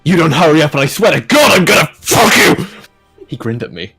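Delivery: ordinary delivery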